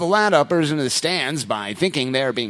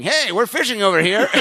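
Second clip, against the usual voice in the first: calling out across the water; yelling; 6 semitones higher than usual. Retold or imitated. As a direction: shout it to someone far off.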